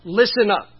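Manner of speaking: loud emphatic Listen up calling for attention. Retold or imitated. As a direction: hit Listen up hard like a command. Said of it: "or with emphasis".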